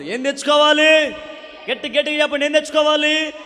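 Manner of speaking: full-force sermon cry; fiery preaching. Acted. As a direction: hammer the promise home to the crowd.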